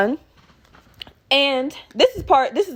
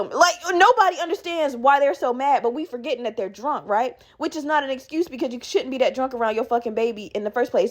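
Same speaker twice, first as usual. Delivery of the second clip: ordinary delivery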